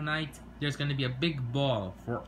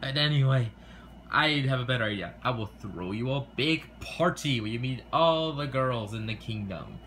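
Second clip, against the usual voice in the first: ordinary talk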